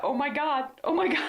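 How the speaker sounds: high-pitched retelling of astonishment; overwhelmed exclamation. Retold or imitated. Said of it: "I just started the".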